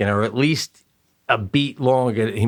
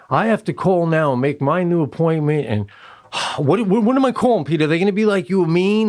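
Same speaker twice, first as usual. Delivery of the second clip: higher-pitched fretting, building; retold exasperation; 5 semitones higher than usual. Wife fretting to Peter about calling, exasperated. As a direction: imitate a worked-up wife.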